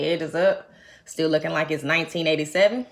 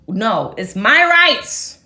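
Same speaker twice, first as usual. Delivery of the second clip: loud, slow insistence; hammed-up rights claim; mock protest; 3 semitones higher, 10 dB louder, much slower than usual. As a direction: draw out the protest loudly. Arguing with YouTube over music.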